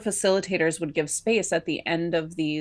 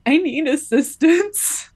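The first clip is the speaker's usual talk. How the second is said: high, louder, slow tearful plea; sobbing plea; 9 semitones higher, 6 dB louder, slower than usual. Retold or imitated. Finally asking the group for help while sobbing. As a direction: force it out through sobs. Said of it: "in full-on sobs at this point".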